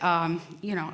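ordinary talk, nothing marked

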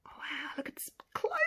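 breath-held, voiceless whisper; hushed excitement; awed whisper. Real, not acted. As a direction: whisper in amazement. Watching the gaps close.

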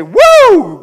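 loud, high victory whoop; joyful whoop. Acted. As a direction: let out a triumphant woo. Bold believer shouting joy.

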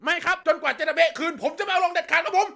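high, formal military voice; stiff soldier reply. Retold or imitated. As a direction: answer like a cadet reporting. Soldier kid answering.

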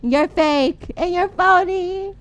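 slow, drawn-out loud whine; melodramatic wailing. Pretend. Player dying, accuses friend who left, mock-wounded wail.

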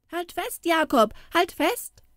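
put-on high, eager boys' cheering; boys egging on. A narrator's reading. Street boys egging Jakob on, high cheering. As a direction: urge him on like excited kids. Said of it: "riefen die Knaben".

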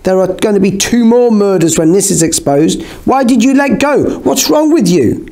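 high-pitched, rising exasperation; panicked accusation. A narrator's reading. Norva blames her friend for letting go, high exasperation.

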